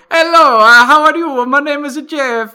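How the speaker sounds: high, loud hammed-up Italian accent in English; fake Italian accent. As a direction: ham up a lovestruck Italian accent. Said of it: "speaking English with an Italian accent".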